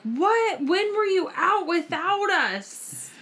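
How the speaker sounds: put-on high, slow outraged child voice; shocked kids. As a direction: imitate the girls' shocked squeal. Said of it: "They were like".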